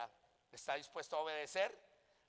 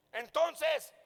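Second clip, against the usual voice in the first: high, loud one-word demand; mock exasperated question, pitched up; retold baffled challenge; 10 semitones higher, 8 dB louder than usual. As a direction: imitate a perplexed pastor demanding an answer. Acts out pastor's baffled challenge.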